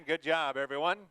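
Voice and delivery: loud praise. Real, not acted. Praising class after loud drill.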